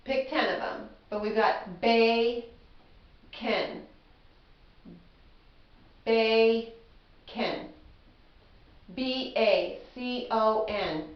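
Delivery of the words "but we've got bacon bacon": very slow BA-CON with a pause between beats; drawn out, syllables clapped apart; slow syllable split. Shown as a demonstration. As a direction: stretch out bacon syllable by syllable. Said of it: "choose ten of the ones".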